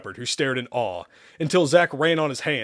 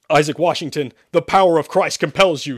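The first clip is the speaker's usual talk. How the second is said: loud, raised exorcist's command; roar; 4 semitones higher, 8 dB louder than usual. Acted. Priest commands the demon Isaac, roaring. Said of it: "Sherman roared once more".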